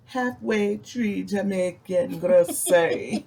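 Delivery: slow, drawn-out mock Jamaican accent; exaggerated, playful Jamaican accent; fake Jamaican accent. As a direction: pronounce the name slowly in a put-on Jamaican accent. Putting on accent for restaurant name.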